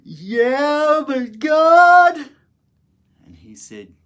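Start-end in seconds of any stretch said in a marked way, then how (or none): 0.0-2.3 much higher and louder, a strained desperate wail; despairing cry. Retold or imitated. Prisoner crying out to Jesus, high and louder in anguish. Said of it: "in my despair".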